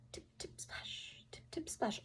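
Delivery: slow, hushed footstep sounds; whispered tip tip splash. A narrator's reading. Narrator whispering the frog hopping to the chair. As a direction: whisper the frog's steps.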